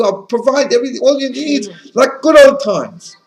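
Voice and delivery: a crafty fox luring the fish home, overly sweet; sly coaxing fox. Acted. Fox coaxes fish out of river.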